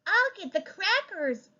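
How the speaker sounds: put-on high, eager child voice; child volunteering, pitched high. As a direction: chirp it like a kid. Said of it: "added the child".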